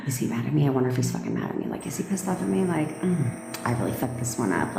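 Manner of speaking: fast, low, quiet fretting after a gasp; mock anxious worrying. Girlfriend spiralling over whether he is mad, mock anxious fretting. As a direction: play the panicked girlfriend spiralling.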